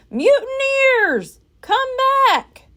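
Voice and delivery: high, slow, loud shout; Captain Bones shouting after his men. A narrator's reading. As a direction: call after the fleeing crew. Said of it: "shouted Captain Bones".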